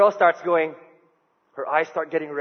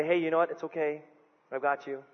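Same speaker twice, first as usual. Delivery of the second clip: softer, gently reassuring; 3 semitones lower, 9 dB quieter than usual. Retold or imitated. Husband embracing wife in the crowd, softly reassuring. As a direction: hold her steady with a soft, calm voice.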